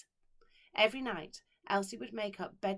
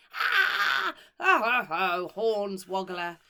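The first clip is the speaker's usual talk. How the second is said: put-on screaming children; 12 dB louder, much slower than usual. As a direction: scream as worms wriggle down your back. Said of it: "wake up screaming".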